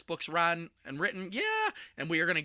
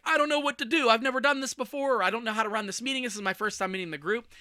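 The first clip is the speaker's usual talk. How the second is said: panicky newbie; 8 semitones higher, 6 dB louder, faster than usual. Retold or imitated. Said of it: "You're like".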